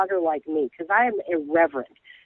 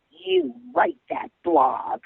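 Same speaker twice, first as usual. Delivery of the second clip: slow, higher-pitched nasty jab; snide tough guy; 7 semitones higher, much slower than usual. Retold or imitated. Cop needling the blogger from behind, snide. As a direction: sneer it slowly like a tough guy. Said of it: "snide and nasty ... tough guy-ish".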